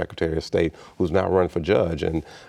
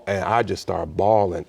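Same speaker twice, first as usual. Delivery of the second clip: ordinary talk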